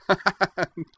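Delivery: a quick high burst of laughter; short bright laugh. A narrator's reading. Anne laughing at Corwin's doubt, bright laugh. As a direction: laugh off the idea lightly. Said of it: "Anne laughed".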